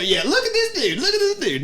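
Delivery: very high, loud mock. Girlfriend's mocking echo, very high voice. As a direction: squeak it out in mockery. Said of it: "and I'm sitting there".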